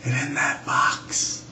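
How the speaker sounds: spooky hushed whisper; ghost story whisper. Acted. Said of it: "whispered in her best ghost story voice".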